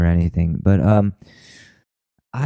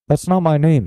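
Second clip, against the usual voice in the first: higher-pitched put-on protest; mock protesting anchor; 8 semitones higher than usual. Anchor denying it to his taunter, mock protest. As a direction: imitate an indignant news anchor. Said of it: "And he'd be like".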